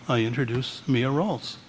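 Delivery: ordinary talk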